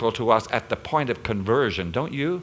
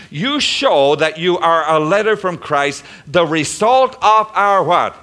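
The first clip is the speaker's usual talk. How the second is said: full-force preaching; 5 semitones higher, 8 dB louder than usual. Acted. Pastor proclaims God's mercy to congregation, full preaching force. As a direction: lift it to a preaching peak.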